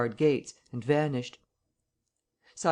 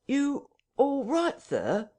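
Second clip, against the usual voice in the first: high, slow, insistent question; sharp questioning; 12 semitones higher, slower than usual. A narrator's reading. Hall calls again after the struggle, sharply insistent. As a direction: press the question sharply, drawing it out. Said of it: "asked Mister Hall sharply, again".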